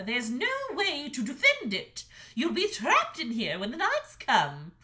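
high, loud blunt refusal; stern mother dragon. A narrator's reading. Mother dragon bluntly refusing her son, high and loud. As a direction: shut him down hard.

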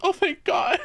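high, crying-out relief; a squeal of relief after running through the airport; relieved cry. Retold or imitated. Panting traveller to the gate agents, crying out with relief.

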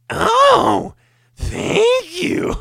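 extremely high falsetto squeal of thanks; exaggerated version. Comic's exaggerated customer thanks, shrill falsetto squeal. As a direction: push it way up into a cartoon squeal. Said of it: "and I went".

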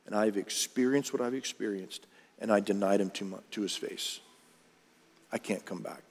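low, hushed admission of failure; quiet despair. Acted. Peter after denying Jesus to his face, quietly despairing. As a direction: let the voice sink, defeated.

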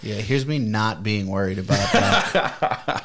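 slow, amused words laughed out; laughing. Real, not acted. Laughing at his friend's French uprising theory. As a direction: laugh your way through it, slowly.

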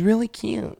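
high whiny voice with distorted vowels; whiny girls impression. Imitating those girls, exaggerated high whine. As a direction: overdo the trendy girl whine. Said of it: "That's my impression of those girls".

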